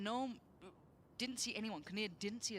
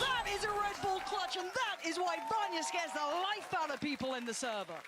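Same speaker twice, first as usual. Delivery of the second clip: excited; 9 semitones higher than usual. Real, not acted. Caster hyping a clutch play.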